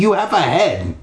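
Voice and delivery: crying out. Acted. Stephanie challenging the headless ghost claim, high outcry. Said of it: "Stephanie cried".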